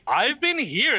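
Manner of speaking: high, loud indignant yelp; indignant protest. Real, not acted. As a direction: insist you were there all along. Protesting he was there all along.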